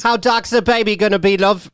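prim tea-lady telling her to shut up; mock English accent. Mocking royals to the host, English accent.

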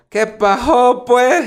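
put-on playful coastal Mexican accent. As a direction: lilt it like someone from the coast. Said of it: "de la playa es como".

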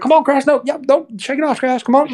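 pitching coach imitation to Crash; old-school coach. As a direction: mimic a tobacco-chewing pitching coach egging him on. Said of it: "chewing on tobacco or whatever".

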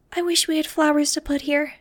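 put-on a small, sad young girl; little girl voice. A narrator's reading. Angel, a little girl, speaks.

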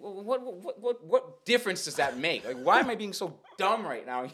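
high, loud, tripping over words; flustered stammering. Retold or imitated. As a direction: stammer out a muddled challenge, playing it up. Flustered attempt at debate, retold.

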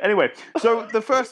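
ordinary talk, nothing marked

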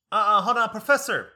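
high, loud imitation of an interrupting classmate; mock loud interjection. Older student halting the professor mid-lecture, mock loud interjection.

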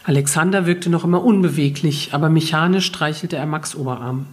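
usual speaking voice

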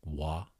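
Wa said alone, soft and careful; practising syllable. Shown as a demonstration. Host drilling himself on the Hawaiian syllable, soft and careful. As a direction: isolate the Wa sound quietly. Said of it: "I don't know why I cannot say wa".

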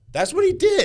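loud, high-pitched exclamation; indignant outburst. Real, not acted. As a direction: burst out in disbelief and outrage. Indignant climax of angry story.

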